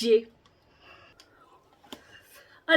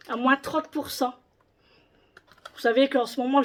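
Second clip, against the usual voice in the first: ordinary delivery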